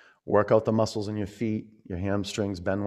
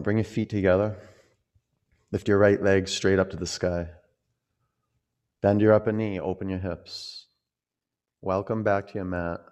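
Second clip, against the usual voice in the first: ordinary talk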